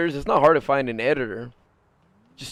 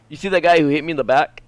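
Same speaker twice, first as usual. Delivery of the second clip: usual speaking voice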